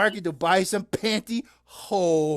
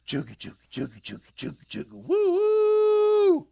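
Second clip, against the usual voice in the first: choo-choo train noise; 11 semitones higher, 3 dB louder than usual. Pretend. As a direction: play train for the kitty. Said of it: "here comes the choo-choo train".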